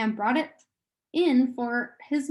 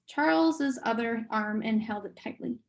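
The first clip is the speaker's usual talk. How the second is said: usual speaking voice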